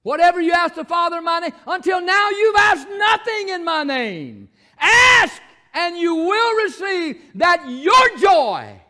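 loud, high shouted scripture; preaching at full force. Acted. Preacher shouting the verse to the congregation, full force. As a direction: thunder the verse at the church.

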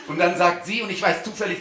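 ordinary talk

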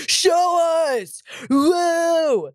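high, long crowd holler; mock heckler whoop. Heckler hollering at the comic on stage, high mock shout. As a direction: imitate a rowdy audience member.

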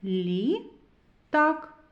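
"Літак" as "Лі", long pause, "так"; syllable by syllable. Shown as a demonstration. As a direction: read "Літак" in two separated syllables. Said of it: "Прочитаємо".

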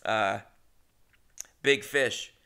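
normal talking voice

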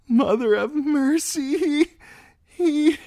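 high, loud wail that stumbles apart; breaking down sobbing. A narrator's reading. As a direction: collapse into sobs mid-sentence. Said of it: "she broke down sobbing".

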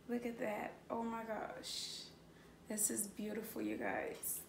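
almost whisper it in wonder; hushed awe. Real, not acted. Vlogger marvelling at the camera in her hands, hushed.

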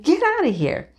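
a quick mock shout to scare a rooster; shooing yell. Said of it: "you know, like".

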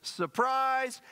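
high-pitched echoed "Surprise!"; mock surprise shout. Mitch looks at preacher and echoes, excited surprise call. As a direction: throw it back high, imitating the friend. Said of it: "Mitch looks at me and says".

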